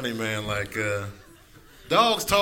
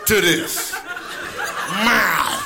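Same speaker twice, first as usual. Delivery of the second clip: angry hungry cat; 6 semitones higher than usual. Retold or imitated. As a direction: chew out the owner like a furious cat. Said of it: "they come in there like".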